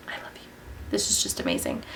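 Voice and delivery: soft unvoiced whisper to the product; whispered adoration. Real, not acted. Vlogger whispering lovingly to her highlighter. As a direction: breathe it like a secret love.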